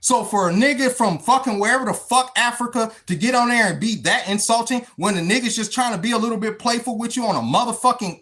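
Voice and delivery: ordinary talk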